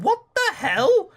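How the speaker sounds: high-pitched baffled outburst; slow, squeaky incredulous question; really confused. Acted. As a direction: draw it out in bewildered disbelief. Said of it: "changed my expression from really confused".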